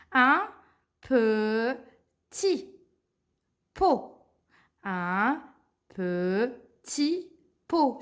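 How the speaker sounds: "petit pot" stretched as peu... ti... po; very slow teacher reading, no Ts; silent final T. Shown as a demonstration. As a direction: sound out "Un petit pot" in separate syllables. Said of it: "on l'a pas prononcé".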